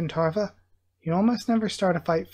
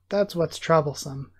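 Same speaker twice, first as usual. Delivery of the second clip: plain reading voice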